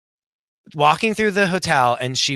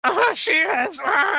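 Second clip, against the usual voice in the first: high, put-on jealous voice; jealous friends. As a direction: fake agreement through clenched jealousy. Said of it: "the other two are like".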